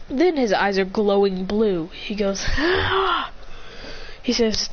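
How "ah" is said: high, contained battle cry; mock power-up cry. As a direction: act out a surge of power without shouting. Powering up, eyes glowing.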